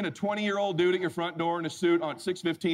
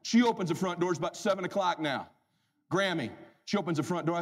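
ordinary delivery

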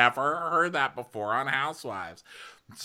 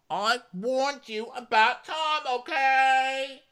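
high, slow, drawn-out mock whine; mocking Ramona; slower than usual. Ramona scolding Kyle about Tom in a high, slow mock whine. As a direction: stretch it out like a snooty housewife. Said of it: "She goes".

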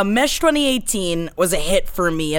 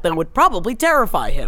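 ordinary talk, nothing marked

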